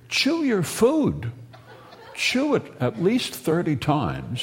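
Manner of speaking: high, slow, bossy mother scolding. Retold or imitated. Delirious mother orders her children to chew, slow and high. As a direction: draw it out like a fussy mother at the table.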